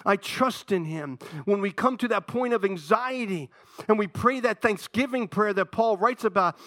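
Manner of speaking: ordinary talk, nothing marked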